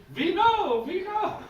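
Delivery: mocking the couples. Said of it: "And they say".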